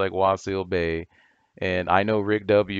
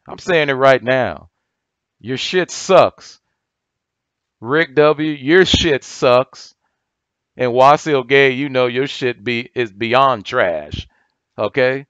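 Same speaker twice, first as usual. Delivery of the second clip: louder and higher, slow hammered insults; angry yelling; 3 semitones higher, 7 dB louder, slower than usual. Real, not acted. Calling out rival gurus by name, loud and angry. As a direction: call each of them out at full volume.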